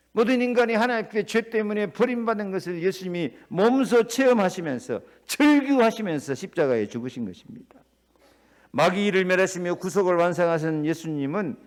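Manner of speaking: usual speaking voice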